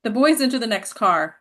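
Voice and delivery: ordinary talk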